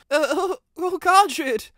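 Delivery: Riley seeing the crushed body; horrified gasp. A narrator's reading. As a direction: choke out the shock.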